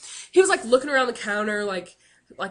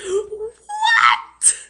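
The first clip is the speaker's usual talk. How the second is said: laughing shout; 10 semitones higher, 7 dB louder than usual. Real, not acted. Caught off guard at end.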